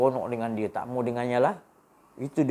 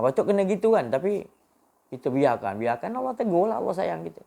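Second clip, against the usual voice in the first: preaching at full force; 7 semitones higher than usual. Acted. Preacher's fervent rise.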